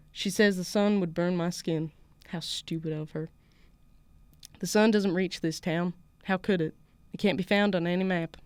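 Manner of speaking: put-on measured story reading in a southern US accent. Podcast host reads her co-host's story aloud, southern accent. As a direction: narrate evenly with a Southern lilt. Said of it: "I didn't practice my southern accent".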